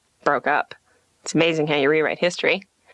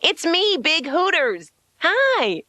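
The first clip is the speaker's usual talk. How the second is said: high, gushing pretend reunion; hammed-up eager recognition; mock delighted greeting; 9 semitones higher, slower than usual. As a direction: squeal hello in fake delight. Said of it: "Run over and go".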